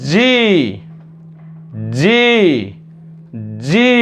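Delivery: জি with a long rising i; model pronunciation. Shown as a demonstration. Teacher models the next letter for learners, long emphatic জি. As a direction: say জি separately, emphatic and drawn out. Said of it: "কি হবে?".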